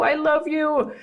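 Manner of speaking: put-on a high, squealed "I love you" like a happy toddler; grateful squeal. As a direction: squeal it out in gratitude. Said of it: "little squeal that sounded like a squeal of gratitude".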